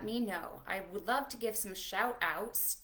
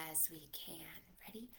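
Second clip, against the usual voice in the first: whispered instruction; 5 semitones lower, 18 dB quieter, faster than usual. Shown as a demonstration.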